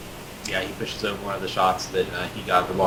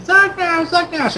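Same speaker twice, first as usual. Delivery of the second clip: put-on very high, loud child voices; excited little kids; 7 dB louder, slower than usual. Children clinging to Zug Nash's leg, excited high kid voices. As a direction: squeal the name like an eager little kid. Kids mob their hero, acted.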